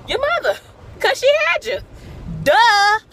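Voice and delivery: very high and loud; shrieked high and loud, mock indignant; emphatic duh. Real, not acted. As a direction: squeal the obvious point. Emphatic aside.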